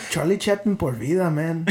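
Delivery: put-on Mexican accent; Charlie Chaplin. Tattooed Chaplin boasting of himself, comic Mexican accent. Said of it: "do a really good Mexican voice".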